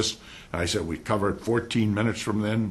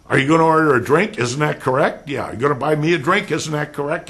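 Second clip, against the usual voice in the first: loud, fast, higher mock legalese; lawyer talk in a bar; 7 semitones higher, 9 dB louder, faster than usual. Shown as a demonstration. Lecturer mocking lawyer talk at a bar, loud and fast. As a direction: badger a barfly like a witness. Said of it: "start talking like that".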